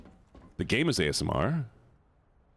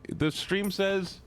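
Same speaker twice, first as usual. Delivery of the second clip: high drawn-out whine; 10 semitones higher, slower than usual. Real, not acted. Exasperated drawn-out complaint.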